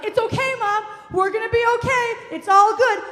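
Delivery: high, loud calling-out reassurance; urgent loud reassurance. Retold or imitated. Daughter acting the adult for mom, high urgent reassurance. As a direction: act out frantically calming someone over noise.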